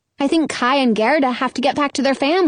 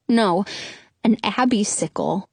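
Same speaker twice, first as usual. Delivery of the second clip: plain reading voice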